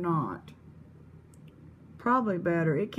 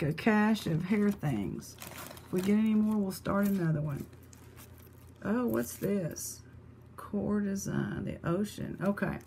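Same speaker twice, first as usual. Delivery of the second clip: ordinary talk, nothing marked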